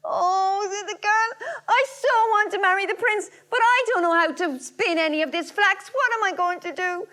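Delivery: high, tearful wail; crying girl. A narrator's reading. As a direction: sob it out, high and desperate. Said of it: "walked up and down the room crying".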